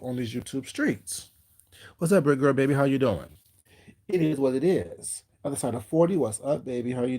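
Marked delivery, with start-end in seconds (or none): none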